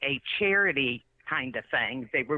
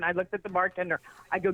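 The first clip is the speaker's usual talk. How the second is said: ordinary talk, nothing marked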